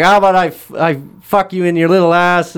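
hammed-up creep pushing his luck; creepy old man. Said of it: "the old man's like".

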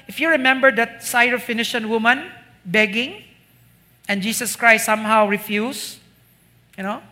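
usual speaking voice

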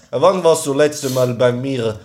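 put-on slow, heavy Russian accent, broken German; an old Russian doctor asking. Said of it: "siebzigjährige alte Russin".

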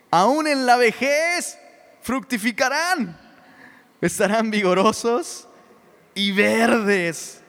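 loud, high, slow proclamation of the verse; booming pulpit reading, drawn out; proclaimed scripture. Acted. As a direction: ring out each word of the verse like a preacher.

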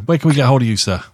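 usual speaking voice

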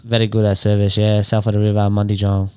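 low mock-French accent. Closing the mechanic plug, low French accent. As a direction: wind it down in low put-on French. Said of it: "in French".

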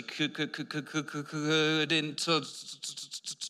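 halting, repeating each first sound slowly; imitated stutter. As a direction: stammer hard on every word start. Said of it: "was a huge stutterer".

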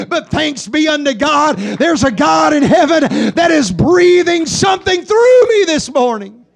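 loud emphatic peak; preaching shout. Acted. Preacher praising God's breath, loud shout. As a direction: hit it hard and loud.

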